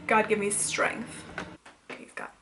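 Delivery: slow, soft plea for patience; praying for patience through teeth. Real, not acted. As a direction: mutter a prayer for patience. Pleading for patience with noise.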